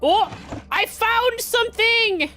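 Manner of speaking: high, slow excited exclaim; a real burst of discovery. Real, not acted.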